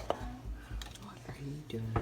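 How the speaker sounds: slow, soft whisper. Real, not acted. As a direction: whisper it, sneaking up. Sneaks up on the others.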